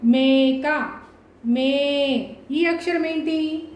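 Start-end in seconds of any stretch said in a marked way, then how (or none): 0.1-2.4 "మే" held long and clear in "మేక"; drawn-out long vowel. Shown as a demonstration. Teacher modelling spelling for children, long "మే" held clearly. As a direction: stretch the first vowel of "మేక". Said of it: "పదాన్ని స్పష్టంగా పలకాలి".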